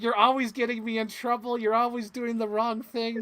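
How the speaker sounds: high, loud, acted whiny blame; mock accusing friend. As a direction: play the fed-up partner complaining. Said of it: "To be like".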